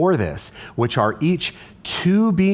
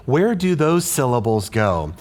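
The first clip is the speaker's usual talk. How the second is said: normal talking voice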